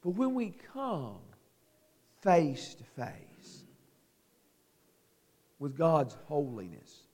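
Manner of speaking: soft, low, intimate sermon voice; hushed preaching. Acted. Preacher drops softly to his flock about God's holiness. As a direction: bring the congregation close, almost confiding.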